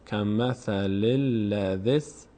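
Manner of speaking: slow, drawn-out Quran recitation; teacher modelling the verse for students. Shown as a demonstration. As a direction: chant it slowly, word by word.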